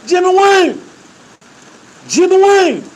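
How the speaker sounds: high, loud holler across the trailer; hollering a name. Retold or imitated. Inmates hollering the captain's first name, loud holler. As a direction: shout the name so he turns around. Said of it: "And so we hollering".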